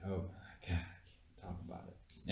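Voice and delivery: breathy embarrassed half-whisper, trailing off. Real, not acted. Confessing to his friend he researched too much, embarrassed whisper. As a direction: whisper it like you're ashamed to admit it.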